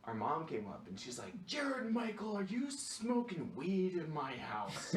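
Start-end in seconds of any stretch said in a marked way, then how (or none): 1.4-4.9 imitating angry mom. Mom catches son smoking.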